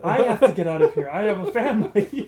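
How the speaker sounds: loud, rising panic, begging for his life; scared guard pleading loudly; panicked guard. Acted. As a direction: blurt it out like a cornered man. Panicking guard begs players.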